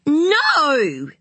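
put-on high, loud furious child's cry; a small boy screaming his refusal; angry little boy yelling no; enraged shout. A narrator's reading. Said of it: "shouted Ziggy, enraged".

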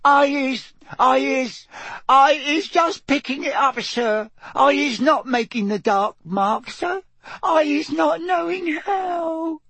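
tearful, very high elf squeal; Winky. A narrator's reading. The elf pleads innocence to Mister Diggory, tearful squeal. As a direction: stammer and wail in a squeaky voice. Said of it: "squealed Winky, tears streaming".

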